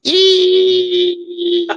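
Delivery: very high thin squeak; a long high squeal like a sliding shoe; imitated squeak. Fighter imitating himself squeaking along the canvas, very high squeal.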